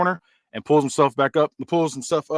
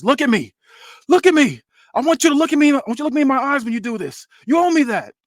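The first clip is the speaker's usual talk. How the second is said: much higher, louder pleading to his son; acted plea from Tony; 11 semitones higher, 5 dB louder than usual. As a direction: make him look you in the eye. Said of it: "Look, he says".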